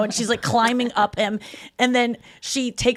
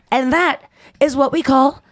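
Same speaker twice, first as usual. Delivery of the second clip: slow, loud, self-satisfied pronouncement; proud drunk Rachel; 5 semitones higher, 5 dB louder, slower than usual. Retold or imitated. Drunk woman ending a call to her ex, mock-triumphant. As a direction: imitate tipsy pride, drawing out the last word. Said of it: "all proud of herself".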